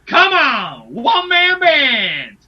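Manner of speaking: high, loud victory whooping; jubilant cheering. Real, not acted. Celebrating the two to three split, jubilant shouting. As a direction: holler like a fan whose side scored.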